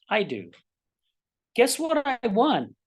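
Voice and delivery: normal talking voice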